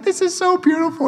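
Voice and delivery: high, breathless gush of delight; mock admiring parents. Parents gushing over the gallery art, mock gushing. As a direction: squeal it like a delighted tourist. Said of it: "they're like".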